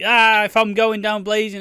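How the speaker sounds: loud, high, hammed-up last stand; mock battle cry. Charging into a shootout with guns in the air, mock battle cry. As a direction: yell it like charging into gunfire. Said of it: "you're going to a fucking shootout".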